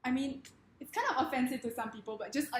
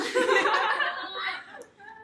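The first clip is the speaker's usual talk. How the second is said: high giggling fit; laugh; 9 semitones higher, 6 dB louder than usual. Real, not acted. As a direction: break into giggles. Cracking up mid-demo.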